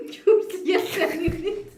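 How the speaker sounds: high, loud, laughing through words; cracking up mid-sentence; stifled giggles. Real, not acted. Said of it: "그 발음이 너무 웃겨".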